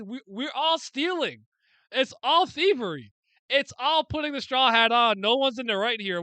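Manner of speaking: rising loud exasperated rant; frustrated ranting to viewers. Real, not acted. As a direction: lean into ALL each time.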